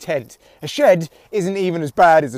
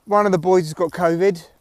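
ordinary talk

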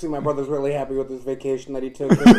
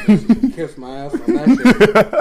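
laughing loudly; 7 semitones higher, 10 dB louder, slower than usual. Real, not acted. Host laughing while ribbing cohost.

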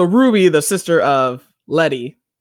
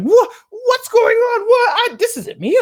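very high, loud panicked squeal; hammed-up panic, laughing at the end; mock freak-out; 4 dB louder than usual. Woman escorted away by police, imitated freaking out. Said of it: "freaking out 'cause she's like".

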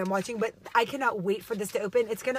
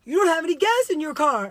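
husband voice; 7 semitones higher, 9 dB louder than usual. Retold or imitated. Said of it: "he'll go".

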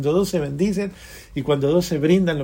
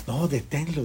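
usual speaking voice

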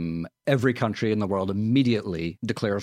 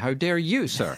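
mock British indignation; 7 semitones higher, slower than usual.

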